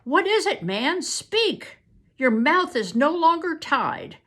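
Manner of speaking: loud, high, sharp order; sharp command. Acted. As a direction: bark the order at the prisoner. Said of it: "Uncle Victor commanded sharply".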